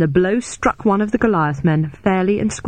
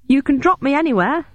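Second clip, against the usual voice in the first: nervous; 10 semitones higher than usual. Acted. Growing alarm.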